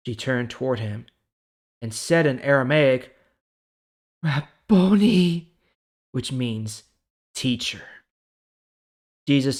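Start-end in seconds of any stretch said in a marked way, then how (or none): 4.2-5.5 higher, slow, drawn-out Rabboni; joyful recognition. Acted. Mary recognizing Jesus, slow awed cry. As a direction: breathe it out in awe.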